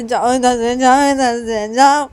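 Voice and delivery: insistent, heated shouting; angry dismissal. Acted. Pushing Sanju away.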